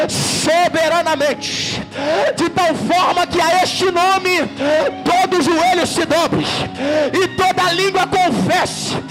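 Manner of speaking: building high, rhythmic exhortation; fervent revival preacher; full-force preaching. Real, not acted. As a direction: hammer out short urgent commands, climbing to a climax.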